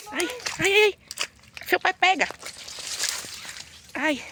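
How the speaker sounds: high squealing cries for help; panicked squeals. Real, not acted. Mother slipping in mud squeals for help. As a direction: squeal as you slip and call for rescue.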